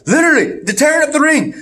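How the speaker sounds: high-pitched, amazed recap; excited emphasis. Real, not acted. Host retelling the arena being wrecked, excited.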